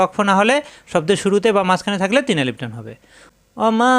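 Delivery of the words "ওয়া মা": recited. Shown as a demonstration. Teacher reciting Quran for students, chanted. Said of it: "Quran recitation demo".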